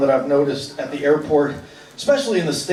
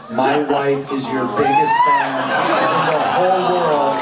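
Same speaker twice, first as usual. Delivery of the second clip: mock gushing fan. Superfan gushing over wedding certificate.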